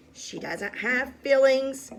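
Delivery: loud, scornful retort; irritated shout. A narrator's reading. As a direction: snap back loudly. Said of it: "shouted Eugenia".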